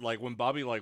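usual speaking voice

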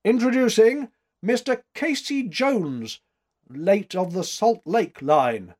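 loud, high showman's introduction, easing off; official announcer. A narrator's reading. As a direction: announce the guest grandly to the room. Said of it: "in his role of official announcer".

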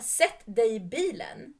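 loud, slow, forceful imitation; retold shouted order. Stranger insisting she get in his car, loud slow order. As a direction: stretch the order out slowly and loudly. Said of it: "för att du skriker på mig".

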